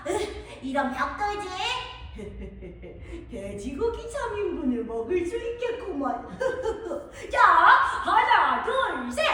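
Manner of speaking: a sly, hungry wolf with a howl and sneaky chuckles; the hungry wolf sniffing out the piglets; hungry big bad wolf. Wolf fails at brick house. (돼지고기 냄새를 맡는 배고픈 늑대)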